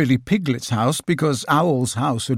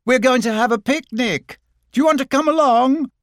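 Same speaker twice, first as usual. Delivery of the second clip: high, raised voice; calling through a door; 12 semitones higher, 4 dB louder than usual. Acted. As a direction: call to someone behind a door.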